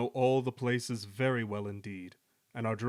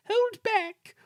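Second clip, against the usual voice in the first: extremely high, squeaky stage whisper; tiny high-pitched conspirator; conspiring whisper; 6 dB louder than usual. A narrator's reading. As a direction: squeak it to the next one. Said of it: "whispered Lucy to Beatrice".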